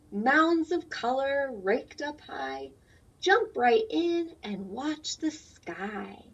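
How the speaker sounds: slow, sing-song rhyming read-aloud; reading to children. A narrator's reading. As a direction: read the rhyme slowly for little ones. Reads picture book aloud.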